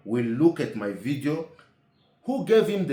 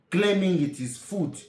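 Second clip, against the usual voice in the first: ordinary talk, nothing marked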